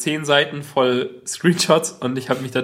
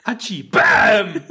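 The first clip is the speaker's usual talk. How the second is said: lautes gespieltes Hatschi, langsam; übertrieben geniest, dann ein Schlag; Niesen; 7 semitones higher, 4 dB louder, much slower than usual. Pretend. (gespieltes lautes Niesen mit Lachen)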